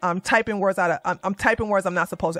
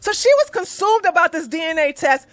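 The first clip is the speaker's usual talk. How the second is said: mocking her; 7 semitones higher, 4 dB louder than usual. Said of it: "And then she said".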